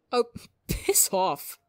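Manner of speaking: slow, laughing brush-off. Acted. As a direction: laugh it out fondly. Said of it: "Tubbo laughs".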